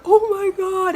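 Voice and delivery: very high, loud, drawn-out fan squeal; starstruck squeal. Retold or imitated. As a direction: shriek like a fan meeting her idol. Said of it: "And I went".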